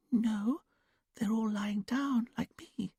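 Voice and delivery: high, slow, whispered dreamy voice; trance whisper. A narrator's reading. Julia in trance answering Dominic, high childlike whisper. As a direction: breathe it out far away, like a child. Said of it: "quiet, sing-song voice".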